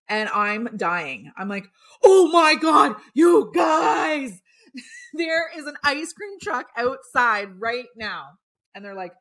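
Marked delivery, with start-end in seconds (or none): 2.0-8.3 high, loud shout, close to laughing; shouting to roommates with laughter, much higher and louder; excited shout. Retold or imitated. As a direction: call the house in disbelief, laughing. Excitedly calling roommates again.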